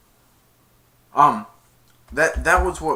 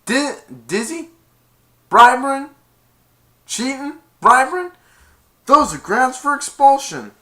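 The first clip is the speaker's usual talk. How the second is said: much higher, loud outraged father; shocked dad; 12 semitones higher, 11 dB louder than usual. Retold or imitated. Dad catching Dizzy cheating, high and loud in outrage. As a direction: sputter in disbelief at the son.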